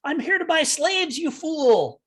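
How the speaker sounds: high, scornful holler at the orcs; hollering in character. Acted. Player's character bluffs the orcs with a high, scornful holler. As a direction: bark it down at them like a haughty slaver. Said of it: "I holler at them in Orcish".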